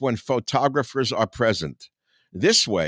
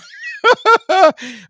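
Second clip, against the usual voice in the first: loud burst of laughter; 10 dB louder than usual. Real, not acted. Laughs at own joke.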